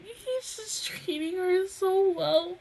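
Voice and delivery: high, slow, choked-up softness; too moved to speak properly; emotional, tearful. Real, not acted. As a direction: squeeze it out softly through happy tears. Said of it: "because I'm so emotional right now".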